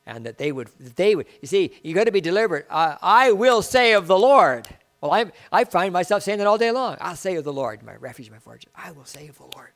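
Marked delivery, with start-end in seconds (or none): 2.7-4.7 loud declaration. Acted. Preacher declaring scripture loudly. 7.0-9.7 hushed prayer. Acted. Quiet recited prayer after declaration.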